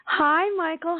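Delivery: ordinary talk, nothing marked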